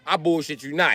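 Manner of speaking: ordinary delivery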